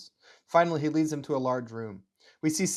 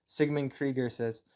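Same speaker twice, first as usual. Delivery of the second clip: plain reading voice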